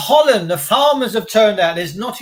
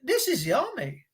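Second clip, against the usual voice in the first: soft, higher-pitched quoted aside; hushed mock reaction; 4 semitones higher, 9 dB quieter than usual. Reacting to police drilling like soldiers, hushed mock awe. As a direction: drop right down, pitch swooping up and down. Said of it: "And I'm like".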